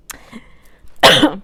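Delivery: toux forte et rauque; quinte de toux. Real, not acted. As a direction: tousse franchement dans le micro. (toux bruyante)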